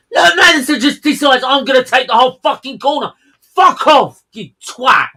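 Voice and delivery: loud, high-pitched angry rant; furious shouting. Real, not acted. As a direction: yell abuse at the driver. Furious rant at driver's move.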